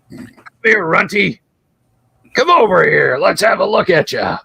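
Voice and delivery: mocking goblin. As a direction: lure the little one in, jeering. Goblin taunting halfling.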